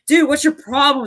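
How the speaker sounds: high-pitched mock confrontation; imitated quarrel. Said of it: "almost like a fight almost broke out".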